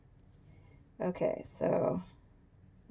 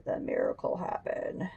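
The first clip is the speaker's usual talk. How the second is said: scary voice; 4 dB quieter than usual. Acted. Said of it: "I got scary voice all of a sudden".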